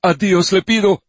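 high, loud pleading cry; desperate plea. Acted. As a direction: beg to God in despair. Desperate father begs.

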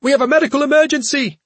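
high urgent cry. Acted. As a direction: cry out in alarm, fast. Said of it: "Cha cried".

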